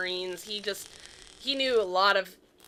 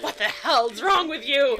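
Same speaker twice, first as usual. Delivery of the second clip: loud, raised, slow mock scolding; unhappy dad; 6 semitones higher, 8 dB louder than usual. Dad scolds his tattling daughter, mock angry. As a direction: scold in exasperation, leaning on each word. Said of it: "My dad was not happy with me".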